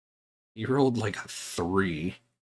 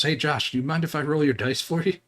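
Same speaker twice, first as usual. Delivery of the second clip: put-on kid's sweet-talk; 8 semitones higher, 5 dB louder than usual. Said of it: "He goes".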